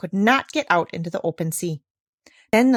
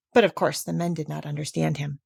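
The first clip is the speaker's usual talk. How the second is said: ordinary delivery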